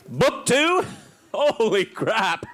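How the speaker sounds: high-pitched, hammed-up disbelief; mock astonishment. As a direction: act floored by the plot, drawing it out. Acting out shock at book two.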